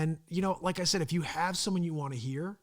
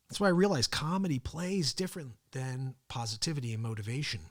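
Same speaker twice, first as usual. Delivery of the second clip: normal talking voice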